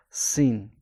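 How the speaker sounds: "سین" with a thin, light s; tongue up towards the palate for a thin "سین". Shown as a demonstration. Teacher showing the letter's sound, thin "سین". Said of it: "تنک دارد".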